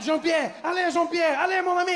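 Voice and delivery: put-on high, shouted French-accented alarm; a French dad spotting the snake; panicked French tourist shouting. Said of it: "He's like".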